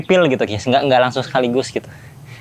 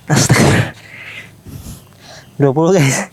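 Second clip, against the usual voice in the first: straining and breathless mid-set; after a workout. Real, not acted. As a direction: push the words out between reps. Counting push-ups mid-set. (terengah-engah saat push-up)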